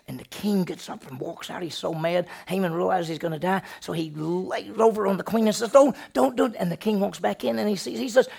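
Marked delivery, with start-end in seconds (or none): 5.7-6.5 high, loud begging; desperate pleading. Acted. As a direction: plead for your life. Haman begging the queen.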